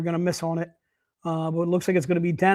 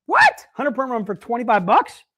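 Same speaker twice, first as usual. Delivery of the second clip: squeaky high hammed-up what; mock surprise; 3 semitones higher, 3 dB louder than usual. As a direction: fake shock for the viewers.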